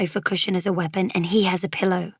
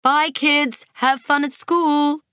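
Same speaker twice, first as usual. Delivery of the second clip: high farewell call; 8 semitones higher, 4 dB louder, slower than usual. A narrator's reading. Parents leave for work, calling out goodbye. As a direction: sing out a goodbye from the door. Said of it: "our parents call as the door slams".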